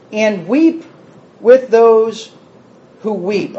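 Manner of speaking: slow, deliberate reading of the verse; measured, weighty scripture reading. Shown as a demonstration. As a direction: lay each phrase down slowly for the listeners. Reading scripture slowly, stressing it.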